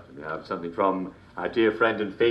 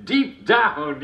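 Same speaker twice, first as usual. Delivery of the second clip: higher, laughing repeat of the punchline; laughing echo; 9 semitones higher, 5 dB louder than usual. Real, not acted. Host repeating his favourite punchline, laughing. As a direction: savour the punchline through a laugh.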